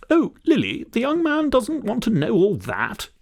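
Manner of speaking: put-on very high, lilting old-lady voice; fussy old sister. Daisy hushes her talkative sister Lily, high fluttery old-lady voice. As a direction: gently scold your sister as a sweet old lady.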